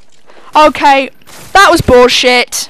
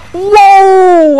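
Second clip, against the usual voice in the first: loud, high startled whoa; jolted by a sudden rocket; 6 semitones higher, 8 dB louder than usual. Real, not acted. Caught off guard by rockets, startled shout. Sudden surprise mid game.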